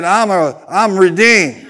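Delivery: slow, loud, proud confession; bold declaration. Shown as a demonstration. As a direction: declare it slowly for all to hear. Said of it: "Come on, say it".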